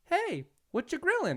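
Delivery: put-on high-pitched little kid voice; Yodel kid. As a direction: pipe up like a curious small boy. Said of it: "I hear just like".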